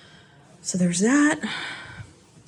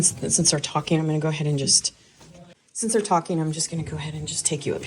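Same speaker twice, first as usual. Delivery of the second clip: hushed; 4 semitones lower, 9 dB quieter than usual. Real, not acted. Family talking downstairs, speaks low.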